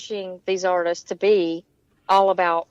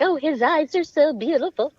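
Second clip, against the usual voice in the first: higher-pitched imitation of a smitten friend; mock swooning; 6 semitones higher than usual. Imitating friend with a crush, mock swooning. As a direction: gush in a teasing lovestruck voice.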